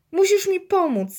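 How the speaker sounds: high-pitched call for help; child calling out. A narrator's reading. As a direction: call urgently to dad. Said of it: "zawołał".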